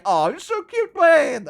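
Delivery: high-pitched teasing gush; cooing friends. Retold or imitated. Friends coo at the angry streamer, teasing. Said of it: "they're like".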